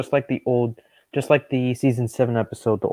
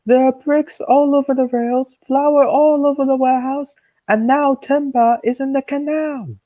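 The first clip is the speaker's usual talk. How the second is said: stern controller; 14 semitones higher, 4 dB louder than usual. Acted. Kid voicing the controller scolding the engines, stern and loud. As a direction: demand it like an angry railway boss. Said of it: "he said sternly".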